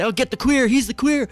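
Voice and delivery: high, excited imitation of kids yelling; playground call-out. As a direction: call it out like a kid mid-chase. Re-enacts kids calling out in game.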